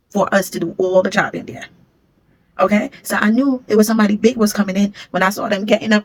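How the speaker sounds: ordinary talk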